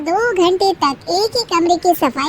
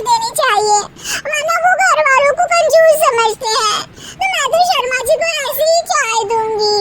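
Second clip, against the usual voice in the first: very high, whiny, near-crying insisting; a spoilt youngest bahu quoting her mother; youngest daughter-in-law; 11 semitones higher, 3 dB louder than usual. Acted. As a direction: youngest bahu arguing with her sisters-in-law over tea.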